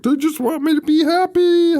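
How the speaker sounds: crazy person voice. Retold or imitated. Movie recap, imitating a deranged, grinning Billy. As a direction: sound cheerfully insane. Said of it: "is talking like a crazy person".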